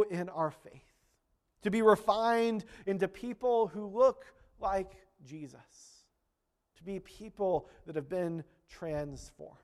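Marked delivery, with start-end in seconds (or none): none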